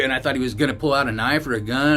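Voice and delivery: ordinary talk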